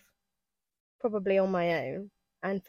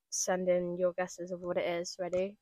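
normal talking voice

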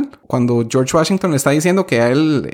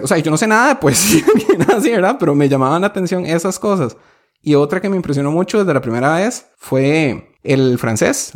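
ordinary talk

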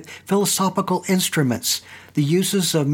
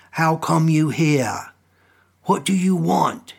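put-on stern old man. A narrator's reading. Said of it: "confronted me sternly".

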